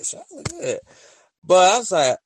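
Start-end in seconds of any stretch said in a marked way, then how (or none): 0.0-0.8 impressed. Retold or imitated. Repeating admiring quote.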